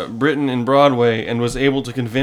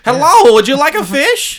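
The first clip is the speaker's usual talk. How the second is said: put-on voice; 12 semitones higher, 8 dB louder than usual. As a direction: ham up a strange clerk offering fish.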